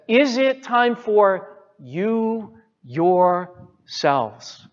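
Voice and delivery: very slow, drawn-out "you ... yourselves"; stress on YOU YOURSELVES. Shown as a demonstration. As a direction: stretch "you" and "yourselves", letting each word hang. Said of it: "There's the emphasis".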